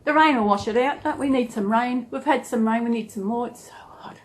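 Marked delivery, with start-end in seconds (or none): 3.5-4.2 whispered complaint. Real, not acted. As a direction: breathe it out in a whisper.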